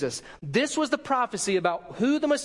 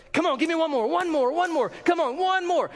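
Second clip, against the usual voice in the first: high, loud urging of one more rep; spotter shouting; 10 semitones higher, 3 dB louder than usual. Retold or imitated. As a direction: drive him through the last rep, shouting. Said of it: "They say".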